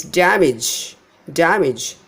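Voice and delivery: damage as DAM-ij, falling; first-syllable stress. Shown as a demonstration. Teacher correcting the mistake, damage as DAM-ij. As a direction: stress DAM in damage, keep the ending short. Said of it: "First syllable is stressed".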